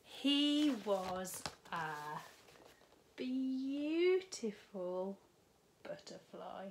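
slow, drawn-out beautiful; wonder-filled slow reveal to children; storybook reveal. A narrator's reading. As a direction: stretch it out for the grand finale.

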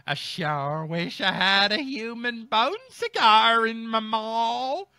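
a deer dreaming of a human bone cigar, over-acted and slow; hammed-up caption voice. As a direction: ham up the deer's cigar line, slowly. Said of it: "That's what it says here in the caption".

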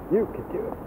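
quiet, gentle repeat; soft encouragement. Shown as a demonstration. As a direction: soften it to a near hush. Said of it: "repeated softer".

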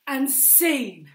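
loud, high, whooping sign-off; over-eager improv host. Acted. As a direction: thank the imaginary crowd with hammed-up excitement.

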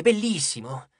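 ordinary delivery

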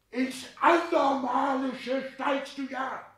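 very high, very slow, drawn out; scandalized cry. Acted. Father scolding son's ambitions, drawn-out scandalized cry. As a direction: stretch every word in horror.

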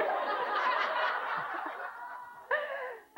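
high, breathy burst of laughing; real laughter. Real, not acted. Speaker laughing at her husband's gorilla panic. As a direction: let the laugh break out.

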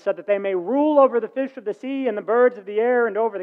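scripture reading. Acted. Preacher reading Genesis to the congregation, lifted voice.

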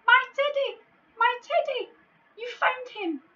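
very high, loud joyful shout; overjoyed cry. Acted. The giant shouts for joy at Tom finding his teddy, high and loud. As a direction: burst out with delight.

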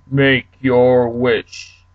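commanding genie. Acted. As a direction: order the third guy like a mighty genie. Genie turns to third guy.

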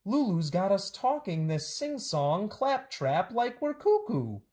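high, lively storybook voices with swooping pitch; bouncy picture-book read-aloud; animated children's story reading. A narrator's reading. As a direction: read it to kids, playing up every silly word.

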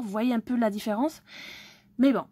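normal talking voice

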